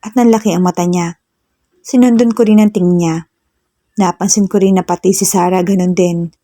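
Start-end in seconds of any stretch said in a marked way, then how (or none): none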